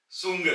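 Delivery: सुंग with a ts start; German word demo. Shown as a demonstration. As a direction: say सुंग clearly. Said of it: "ये बन जाएगा सुंग". (सुंग, त्स की आवाज से)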